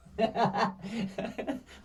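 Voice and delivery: real rising laugh. Real, not acted. Speaker laughing after recalling his threat to his mates, real laugh. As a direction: laugh at your own threat.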